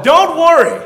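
high, loud rallying call; loud exhortation. Shown as a demonstration. Preacher prompting the congregation to tell their neighbours, loud and emphatic. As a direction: belt it out to rally the crowd.

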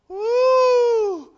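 high, loud whoop; excited whoop. Acted. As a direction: whoop with delight at the top. Yertle cheering high up.

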